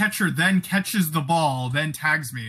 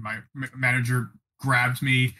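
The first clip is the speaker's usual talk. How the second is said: ordinary talk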